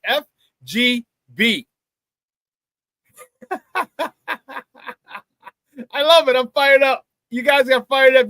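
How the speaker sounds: ordinary talk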